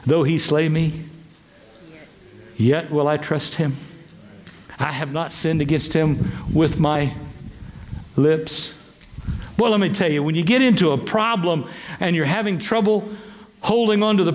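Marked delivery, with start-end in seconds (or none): 0.1-8.7 solemn faithful Job. Retold or imitated. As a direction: speak it low like a humble vow.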